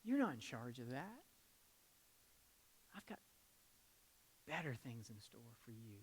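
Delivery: soft, low reassuring words; a quiet fatherly voice of God; God's gentle voice. Retold or imitated. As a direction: speak tenderly as God reassuring a worrier. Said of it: "He's like".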